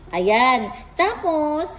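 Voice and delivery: ordinary delivery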